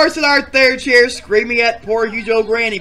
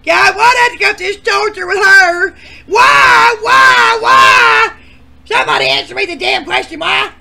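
high, loud desperate cries of why; anguished shouting; 10 semitones higher, 9 dB louder than usual. Acted. Contestant begging the host for an answer, anguished shout. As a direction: wail the questions in despair. Character pleading angrily.

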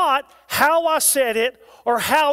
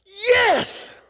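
high, drawn-out cry of yes; exultant shout; 8 semitones higher than usual. Acted. Preacher voices being moved internally by truth, high exultant cry. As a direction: call it out as the spirit's reply. Acts out spirit's shouted response.

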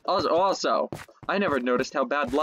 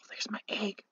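unvoiced whisper, much softer; 6 semitones higher, 12 dB quieter than usual. Real, not acted. Player spotting his lost egg, whispered. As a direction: breathe it out in relief. Finds egg after dying.

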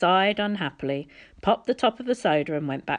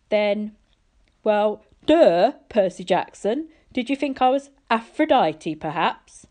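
sarcastic god; 4 semitones higher, 6 dB louder than usual. A narrator's reading.

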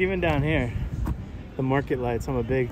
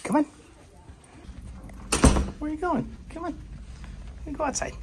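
much higher, soft voice for the dog; squeaky coaxing to a puppy; high pet voice; 6 dB quieter, faster than usual. Real, not acted.

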